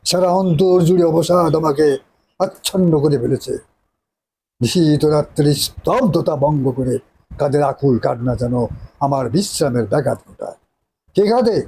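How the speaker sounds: grand theatrical declamation as a nawab; hammy stage actor. Acted. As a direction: declaim it like a tragic stage hero. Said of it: "নায়কের dialogue আলাদা".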